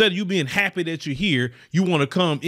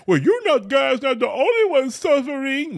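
high, put-on whining voice; mock whiny retort; 9 semitones higher than usual. Imitating other groups answering back, in a high mock whine. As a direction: parody their defensive comeback. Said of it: "these other groups come back".

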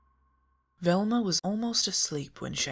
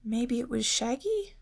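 Daphne guessing; 10 semitones higher than usual. A narrator's reading. Daphne deflects, voiced high.

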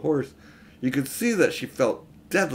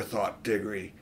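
usual speaking voice

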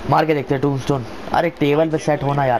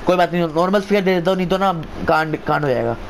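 normal talking voice

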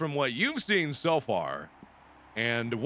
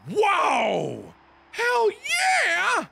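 excited whoop; 5 dB louder, much slower than usual. Real, not acted. Found a camp.

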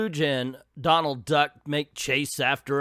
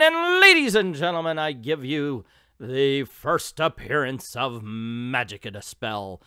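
announcer; 4 dB louder than usual. Pretend. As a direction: present the grand debut like a ringmaster. Mock announcer introduces character.

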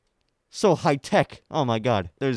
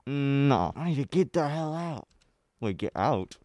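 usual speaking voice

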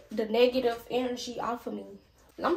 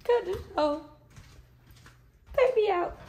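mock squeaky demand; 9 semitones higher, 6 dB louder than usual. Joking aside to camera while filming.

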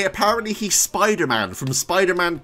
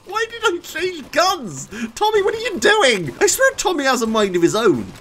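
high-pitched, loud exasperation; exasperated outburst; 13 semitones higher, 4 dB louder than usual. Real, not acted. Player scolding his game character over guns, exasperated outburst. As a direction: wail at the character in frustration.

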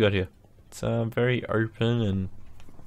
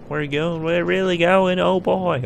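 from eager to a much higher, louder whoop; riding the cart, getting louder and higher; building excitement; 7 semitones higher, 6 dB louder than usual. Real, not acted. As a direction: let the thrill climb into a shout. Minecart speeding, rising thrill.

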